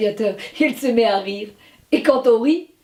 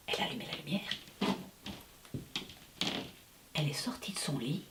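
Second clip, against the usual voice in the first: hushed storyteller whisper; 8 semitones lower, 24 dB quieter, faster than usual. A narrator's reading. Narrator telling of the noise in the dark, whispering. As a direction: whisper it to build suspense. Suspense in bedroom.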